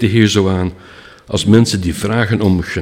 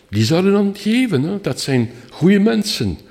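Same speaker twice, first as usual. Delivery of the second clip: hopeful beggar; 10 semitones higher than usual. Retold or imitated. Beggar's hopeful thoughts voiced.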